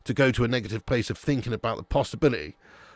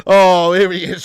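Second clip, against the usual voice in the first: excited greeting; 6 semitones higher, 12 dB louder than usual. Real, not acted. Greeting a viewer arriving in chat.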